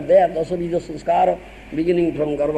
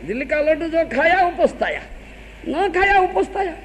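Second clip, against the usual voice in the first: quoting the proverb with a lift, a little higher; saying recited higher; 8 semitones higher than usual. Shown as a demonstration. The monk repeats the proverb to his listeners, higher. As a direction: recite the proverb again with a raised, lilting voice. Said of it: "in our Odia".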